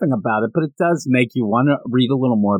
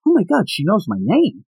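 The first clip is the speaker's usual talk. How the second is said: higher-pitched imagined startled reaction; mock amazement; 7 semitones higher than usual. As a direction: play a smitten kid caught off guard. Voicing the boy's startled inner reaction.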